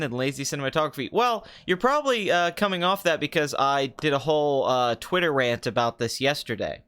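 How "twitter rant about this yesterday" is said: ordinary talk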